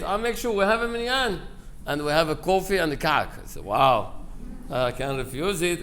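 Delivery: ordinary talk, nothing marked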